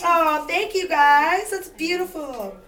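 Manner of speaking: high, loud, slow delighted gush; overjoyed gasp of thanks; delighted opening a present. Real, not acted. Trying on the gift necklace.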